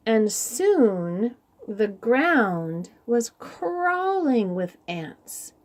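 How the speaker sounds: ordinary delivery, nothing marked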